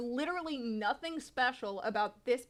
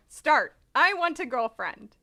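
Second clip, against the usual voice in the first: ordinary delivery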